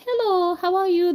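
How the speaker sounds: mock-polite British accent, pitched high; high-voiced imitation British greeting. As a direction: put on a prim British hello. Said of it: "like, a whole British accent".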